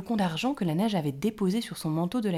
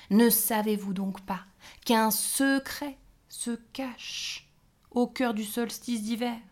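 louder gnome, very slow on the secret; mysterious hint; 4 semitones higher, 5 dB louder, slower than usual. A narrator's reading. The gnome teases the fairies with a secret, slow and mysterious. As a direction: dangle the secret, drawing it out.

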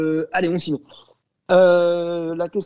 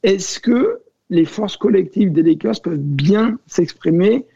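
usual speaking voice

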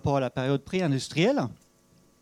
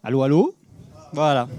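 loud mic check; 9 dB louder than usual. Real, not acted. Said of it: "il faut que je parle plus fort".

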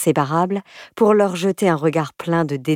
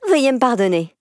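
higher, cheeky mock-polite schoolgirl; insolent sweetness; 6 semitones higher than usual. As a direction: needle them with fake politeness. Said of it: "avec un culot hallucinant".

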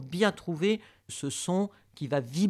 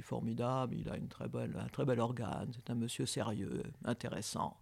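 deep serious voice; 3 semitones lower, 7 dB quieter than usual. Retold or imitated. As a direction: lower and softer, voice held in the throat. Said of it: "un monsieur qui parle comme ça".

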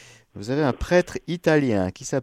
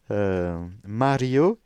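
usual speaking voice

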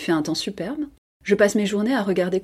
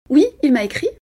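higher, louder affirmation; insistent lie; 7 semitones higher, 6 dB louder than usual. A narrator's reading. Cousin daring to lie about a letter, insistent. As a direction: pitch it up and assert the lie. Woman lies to her cousin.

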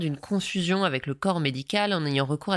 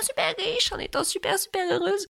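much higher, sing-song parody of a gushing influencer; sarcastic high-pitched imitation of a self-made millionaire; mock gushing coach. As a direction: squeal it like a fake-happy life coach. Mocking the coach's storytelling.

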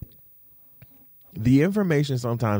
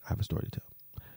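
breathy whispered tease; 3 semitones lower, 14 dB quieter, faster than usual. Real, not acted. Vlogger whispering to viewers about a secret story.

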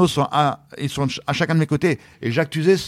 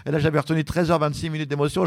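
ordinary delivery

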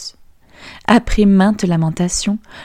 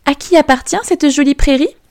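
put-on higher, curious girlish voice; princess asking; 8 semitones higher than usual. Princess asking the fiddler about the forest, higher and curious. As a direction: wonder at the forest, pitched up. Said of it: "demanda-t-elle".

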